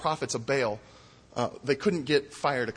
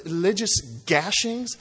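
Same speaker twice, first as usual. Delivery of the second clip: ordinary talk, nothing marked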